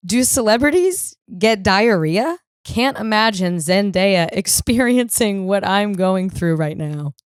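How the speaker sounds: slow, dramatic meme read. Shown as a demonstration. Comedian reading a diarrhea meme aloud, mock solemn read. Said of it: "Said meme reads".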